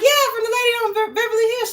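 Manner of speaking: high, loud and fast, thrilled; excited recognition. Retold or imitated. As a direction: rattle it off, high and giddy. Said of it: "I was just like".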